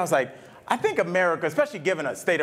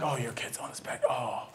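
soft, lowered voice of mock sympathy; hushed, patronizing imitation of pity; condescending; 6 semitones lower, 9 dB quieter than usual. People reacting to his kid's diagnosis, mock condescending. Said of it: "this weird, like, condescension".

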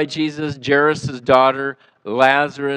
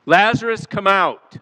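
a raised call at the tomb, higher pitch; hollered call; 6 semitones higher than usual. Shown as a demonstration. Preacher hollers to the dead man in the tomb. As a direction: holler the name like calling into a cave. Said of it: "I want you to holler that with me".

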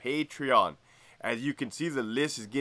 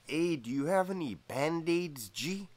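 softer, higher mimicked question; quiet put-on voice, raised pitch; Greñas impression; 4 semitones higher, 6 dB quieter than usual. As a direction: imitate a buddy asking, lilting up on G. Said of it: "He kinda talked like this".

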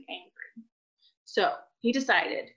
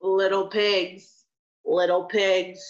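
slow, low wolf call; big bad wolf; 3 semitones lower, slower than usual. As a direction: coax them slowly. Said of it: "said the big bad wolf".

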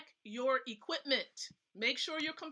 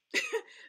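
a squeaky high laugh. Real, not acted. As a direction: laugh at your own joke. Laughs at pun on deep.

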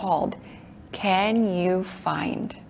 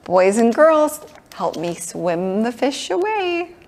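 normal talking voice

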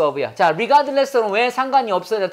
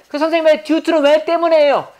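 lightly voiced student, higher pitched; higher-pitched mock student query; student asking; 6 semitones higher than usual. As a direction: put a puzzled question to the teacher as a pupil.

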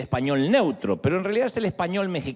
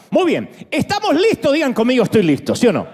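much higher and louder, pumping up the room; rallying call to the crowd; 10 semitones higher, 7 dB louder than usual. Real, not acted. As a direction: call out to the crowd, loud and high. Pastor rallies the congregation. (predicador animando a la congregación con gritos)

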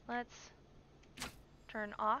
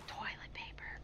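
gasp; 8 dB quieter than usual. Real, not acted. Sneaking through a store.

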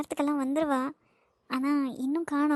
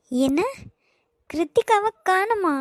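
shocked; 4 semitones higher, 4 dB louder, slower than usual. Acted. Mother hears daughter is missing.

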